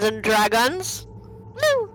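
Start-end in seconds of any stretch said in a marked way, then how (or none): 1.6-1.9 high, bright whoop; excited cheer. Real, not acted. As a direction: cheer the start of the game. Cheering at the show's opening.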